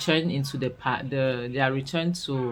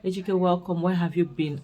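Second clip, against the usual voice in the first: higher, gushing greeting to the returning rich man; mock warm welcome; 3 semitones higher than usual. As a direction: fawn over him like a long-lost hero. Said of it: "they just have to welcome him with open arms".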